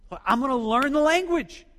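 the friend firmly insists he will learn; eager insistence. Retold or imitated.

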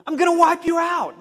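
high, loud divine threat; God's wrath booming down on Nineveh; thundering threat. Retold or imitated. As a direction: roar it out as a furious God. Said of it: "the message that Jonah wanted to preach".

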